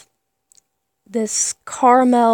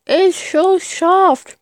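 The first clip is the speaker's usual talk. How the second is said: high, slow words round a mouthful of toffee; mouth full; 8 semitones higher, 4 dB louder, much slower than usual. Real, not acted. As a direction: talk through chewy candy, drawn out. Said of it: "it's rude to talk with a full mouth".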